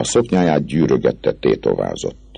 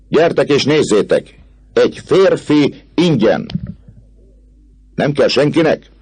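crowd-calling auction; 14 semitones higher, 4 dB louder than usual. A narrator's reading. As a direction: hawk him to the women like a barker. Clopin auctions him to women. (harsányan kikiáltó Clopin)

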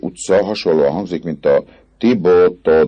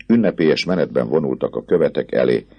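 ordinary delivery

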